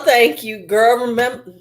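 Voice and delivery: ordinary talk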